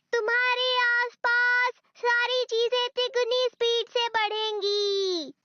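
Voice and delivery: drawn-out, spelled-out words; deliberately slow. Acted. Friend repeating for a confused listener, slow speech. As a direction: stretch every word so he follows. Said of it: "मुझे धीरे बोलना पड़ेगा".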